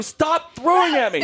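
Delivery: high, slow pleading yell; high, slowed, half-laughing yell; high mock yell. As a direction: beg him to stop, up high. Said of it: "I'm, like, yelling".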